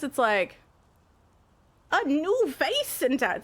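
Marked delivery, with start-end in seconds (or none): 1.9-3.4 higher, slow would-be Irish accent; failed Irish accent attempt. Shown as a demonstration. Streamer showing why it fails, attempted Irish accent. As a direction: attempt a drawn-out Irish lilt. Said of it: "See, I can't do it".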